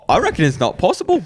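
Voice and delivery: high, quick British voice drifting off; mock British accent. Brit doubting straight teeth are possible, wobbly mock British accent. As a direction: attempt British, let it wander. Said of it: "it always turns weird at the end".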